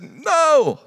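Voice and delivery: high, loud shouted answer. Acted. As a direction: yell it back from the boat.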